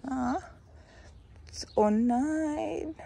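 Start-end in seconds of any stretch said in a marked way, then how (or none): 1.8-2.9 long, high-pitched "oh no"; dismay. Real, not acted. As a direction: draw it out in rising dismay. Dismayed that the animal got out. (bestürzt, langgezogen)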